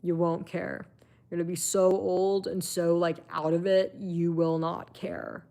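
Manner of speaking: usual speaking voice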